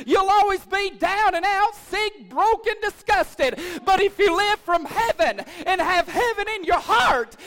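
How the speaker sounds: loud, high, driving revival shout; full-force preaching. Real, not acted. Pastor urging church to trade teaspoon for shovel, full-force preaching. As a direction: preach it out, building to a ringing hallelujah.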